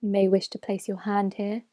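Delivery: ordinary talk, nothing marked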